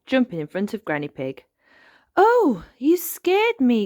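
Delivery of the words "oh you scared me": high, loud mock fright; drawn-out exclamation of playful shock; startled. A narrator's reading. Granny startled by the ghost, playfully shocked.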